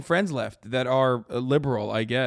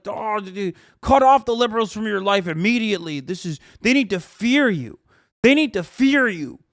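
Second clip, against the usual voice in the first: imitating a worked-up, furious commentator; loud, raised-pitch mocking rant; mock angry rant; 4 semitones higher, 5 dB louder than usual. Streamer mimicking the man in the clip, mock angry. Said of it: "He's so mad. He's like".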